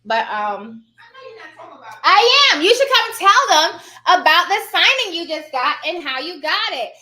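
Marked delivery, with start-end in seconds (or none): 2.0-6.9 loud, lifted call across the room. Real, not acted. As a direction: call someone over, loud and high to start. Calls out to mother to share.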